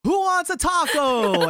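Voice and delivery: put-on high, loud, slow drunken call; drunk sing-song offer. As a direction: drag it out like a wasted guy waking everyone. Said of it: "he goes".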